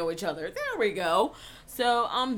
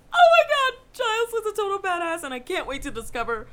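high, loud gush that settles down; squealed excitement easing toward normal; excited; 12 semitones higher, 9 dB louder than usual. Retold or imitated. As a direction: gush like a thrilled fan, then ease off. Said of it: "I'm excited".

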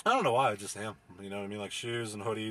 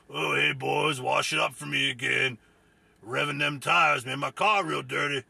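louder, smug put-on voice of a cigar-smoking dude; smug car wash guy; 4 semitones higher, 6 dB louder than usual. Mocking a smug customer calling to the car wash workers, in a louder put-on voice.

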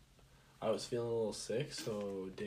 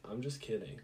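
ordinary talk, nothing marked

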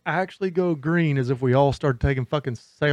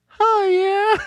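high far-off mock kid call, much higher; imitating son calling back; 4 dB louder than usual. Dad imitates son answering from upstairs, high mock call. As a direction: do the son answering from his room, pitched way up. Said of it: "I hear him yell from his room".

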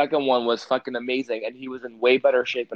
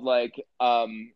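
ordinary talk, nothing marked

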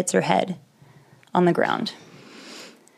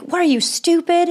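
rude driver's yell; 10 semitones higher than usual. Retold or imitated. Driver yelling at stalled car.

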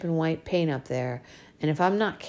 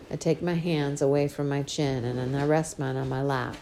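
plain reading voice

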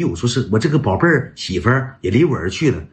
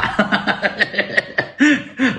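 high, rapid-fire giggling laugh; helpless run of high laughter; 9 semitones higher than usual. Real, not acted. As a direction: burst out in a long, high cackle. Laughing at the retold request.

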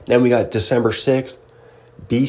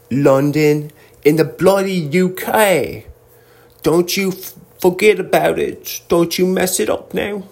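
high-pitched, hammed-up British accent; mock British accent; 7 semitones higher than usual. Host adding London tour date, hammed-up British accent. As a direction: put on a silly high British voice.

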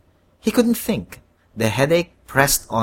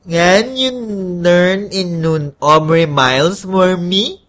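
slow, higher, congested voice; stuffy-nosed request; 5 semitones higher, 5 dB louder, slower than usual. A narrator's reading. Congested man asks the shape-shifting devil for another star, stuffy-nosed. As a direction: make the request through a blocked nose. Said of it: "with a stuffy nose".

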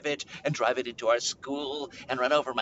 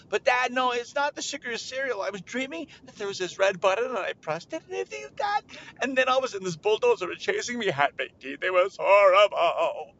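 put-on high, insistent kid voice; upset child; 5 semitones higher, 4 dB louder than usual. Child explains a nightmare to Dad, high distress. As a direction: plead like a frightened child, ending loud.

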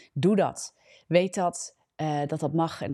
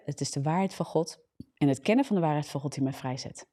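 normal talking voice

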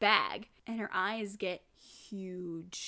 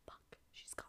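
barely audible stunned whisper; acted hushed dismay; whispered realization; 24 dB quieter than usual. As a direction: whisper it under your breath, retelling her discovery. Voicing Toka finding Hinami missing.

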